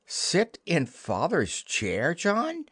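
slow, shocked question rising sharply high on John; aghast at the very idea; scandalized. Acted. Wendy scandalized at John, slow shocked rising question. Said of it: "Wendy was scandalized".

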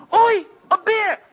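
put-on high, slow frightened child's cry; a small boy startled by a bear; scared child cry. Young son sees the bear, crying out in mock fright.

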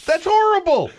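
laughing. Real, not acted. Laughing at the bit.

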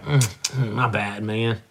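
low, soft sorry my bad; soft remorseful mumble. Shown as a demonstration. Co-host trying out the chest-pat apology, soft and low. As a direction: mumble it like you mean it. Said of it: "Let me try".

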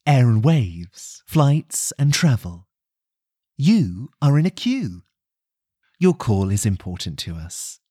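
slow, deep put-on recorded message; fake phone menu voice. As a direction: pretend to be the automated queue. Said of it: "be a bit naughty with this next call".

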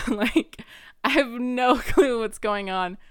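loud laughing burst. Real, not acted. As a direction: laugh through the words. Laughing at her own confusion.

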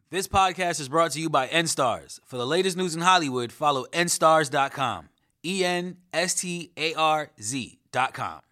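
ad read. Acted. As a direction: read the plug like a radio ad. Sponsor read.

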